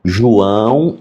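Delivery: "João" stretched out syllable by syllable, rising; over-articulated. Retold or imitated. Teacher calls deaf pupil João, "João" drawn out and over-articulated. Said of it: "e bem articulado assim".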